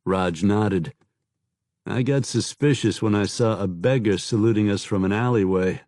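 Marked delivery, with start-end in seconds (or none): none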